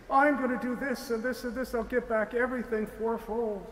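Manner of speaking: high, loud, eager promise of amends; happy Zacchaeus. Acted. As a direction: burst out gladly, nothing grudging. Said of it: "he's not saying it in a grudging way. He's, he's happy".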